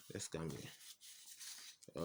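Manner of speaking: slow, breathy whisper under the breath. Real, not acted. Teacher murmuring to himself while moving on, whispered. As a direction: drop to a near-silent aside.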